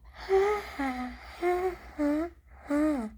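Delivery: retold mean laugh, loud and high; mocking laugh. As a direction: mimic a gloating girl's cackle.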